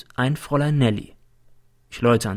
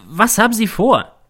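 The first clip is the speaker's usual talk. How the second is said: high-pitched, raised-voice demand; shouting; 10 semitones higher, 4 dB louder than usual. Acted. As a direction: yell the question at him. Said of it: "schrei ich ihn an". (aufgebracht angeschrien)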